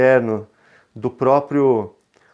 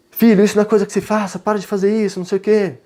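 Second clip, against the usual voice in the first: higher, louder, rushed telling-off; scolding parent; 7 semitones higher, 3 dB louder, much faster than usual. Acted. Parent telling son to stop misbehaving, scolding. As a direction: scold the child in a quick, raised voice. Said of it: "se eu simplesmente falasse assim".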